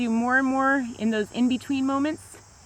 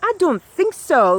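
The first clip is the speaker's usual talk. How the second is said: sassy; 3 dB louder, slower than usual. Retold or imitated. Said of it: "she went off".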